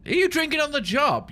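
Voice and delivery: hammed-up high-pitched telling-off; mock scolding voice. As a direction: play a shrill busybody catching someone drinking. Mock scolding the game character.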